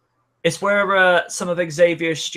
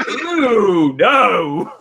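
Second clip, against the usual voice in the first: mock shocked ooh; 4 semitones higher, 6 dB louder than usual. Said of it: "it's like".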